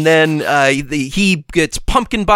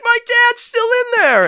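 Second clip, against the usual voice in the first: very high, put-on panicked son; Harry voice. Harry telling Spider-Man about his dad, very high mock panic. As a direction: squeal it like a frightened kid. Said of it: "And he's like".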